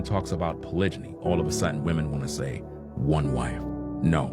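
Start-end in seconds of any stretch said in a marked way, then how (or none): none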